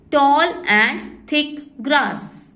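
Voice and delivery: words stretched far apart; very slow dictation. Shown as a demonstration. Teacher dictating to children, very slowly. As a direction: drag it out for copying. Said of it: "dictating meaning".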